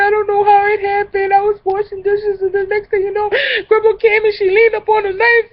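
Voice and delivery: high, sobbing, shouting apology; wailing tearful fake confession; fake crying. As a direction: sob it out like a guilty wreck. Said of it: "but I'm crying".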